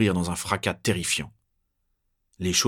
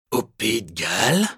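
calmer, dangerous; 3 semitones higher than usual. A narrator's reading. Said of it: "il répète plus calme et plus dangereux".

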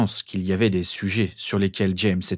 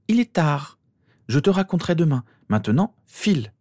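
higher, brisk motherly command; cutting order; 9 semitones higher than usual. A narrator's reading. Mother ordering her daughter to bed, cutting tone. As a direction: cut the child's protest short. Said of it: "ordonna Lily d'une voix coupante".

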